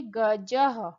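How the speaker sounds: गजः ending in a clear breathy ह, said ga-jah; visarga sounded. Shown as a demonstration. Sanskrit teacher demonstrating visarga: गजः with a clear final ह. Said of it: "इसका उच्चारण ह ऐसा बोलता है".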